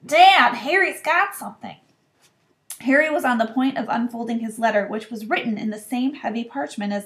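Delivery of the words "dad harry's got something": put-on whiny boy. A narrator's reading. Dudley calls out.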